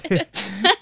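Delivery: short, high burst of laughter; shared real laughter. Real, not acted. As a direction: laugh along with her. Laughs along.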